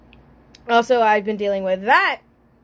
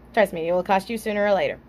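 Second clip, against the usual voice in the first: ordinary talk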